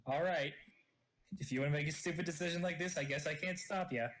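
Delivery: ordinary delivery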